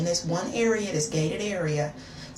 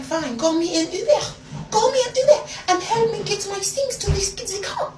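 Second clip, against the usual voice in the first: put-on high-pitched, indignant French accent; 13 semitones higher, 4 dB louder than usual. Agreeing to leave boyfriend's house, in a high French accent. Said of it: "I turned into a whole French woman".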